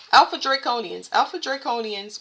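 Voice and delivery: title called out loud; loud read-out of a heading; loud announcing. Real, not acted. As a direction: announce the name loudly. Reading the title aloud.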